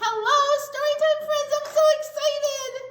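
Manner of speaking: squeaky-high excited puppet giraffe; high, loud giraffe puppet voice. Pretend. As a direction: play the giraffe puppet bursting with glee. Puppet giraffe greets kids.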